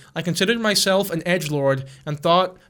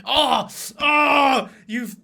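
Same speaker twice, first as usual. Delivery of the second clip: mock melodramatic wail; 4 semitones higher, slower than usual. Mocking Lily Tino's complaint to viewers, hammed-up sobbing wail. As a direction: overact a wounded martyr's wail, then trail on. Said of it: "She's like".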